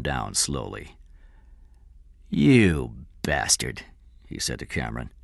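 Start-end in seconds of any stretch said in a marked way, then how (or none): 2.3-3.8 slow, low, drawn-out curse; bitter accusation. A narrator's reading. King curses Cameron for betraying him, bitter. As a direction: draw the insult out slowly.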